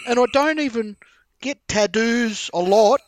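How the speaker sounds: high, loud imitation of a bawling Aussie girl; hammed-up tearful wail, stretching the words; mock bawling. Retelling a crying Aussie girl's Bali tattoo story, mock bawling. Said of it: "she's bawling. She's fucking bawling, and she's like".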